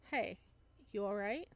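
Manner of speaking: ordinary talk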